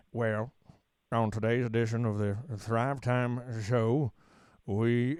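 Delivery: slow, low Oklahoma twang with long pauses; Oklahoma drawl. Shown as a demonstration. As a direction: drag out every word like an Oklahoma drawl. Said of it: "So you'd wanna say".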